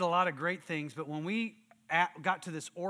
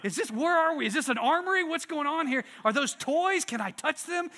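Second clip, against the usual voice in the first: high-pitched astonished questions; amazed visitor; 7 semitones higher, 4 dB louder than usual. Retold or imitated. Raj seeing the gun wall at the store, imitated amazement. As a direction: mimic someone gawking in disbelief. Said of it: "When Raj came here".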